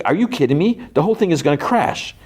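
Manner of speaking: high-pitched mock outrage; acted incredulous protest; exasperated retort. Acted quarrel in the story.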